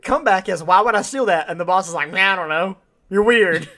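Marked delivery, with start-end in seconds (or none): 0.6-1.5 loud, high, indignant; mock indignant comeback. Quoting Arthur's comeback to his boss, louder and higher. 2.1-3.7 loud, high, shrugging it off; dismissive, laughing. Retold or imitated. Retelling the boss dismissing Arthur, loud and laughing.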